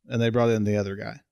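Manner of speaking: normal talking voice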